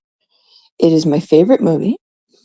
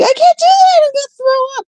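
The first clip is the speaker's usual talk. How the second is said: put-on very high, whiny teen protest; whiny teenage self. Teen refuses two sandwiches for lunch, very high whine. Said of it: "I'm like".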